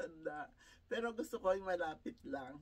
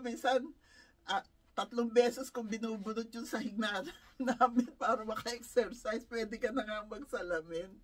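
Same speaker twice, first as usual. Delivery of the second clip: usual speaking voice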